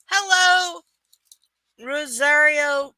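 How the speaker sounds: bright, loud hello to chat; loud greeting. Real, not acted. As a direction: call out hello.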